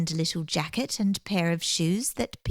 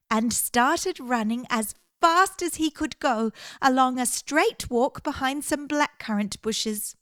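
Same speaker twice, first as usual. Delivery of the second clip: usual speaking voice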